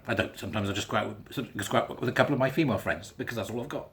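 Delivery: usual speaking voice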